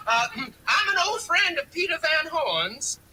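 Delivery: hammed-up Southern US accent, pitched high; Jimmy Carter. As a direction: disguise your voice with an overdone folksy Southern accent. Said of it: "Make an accent".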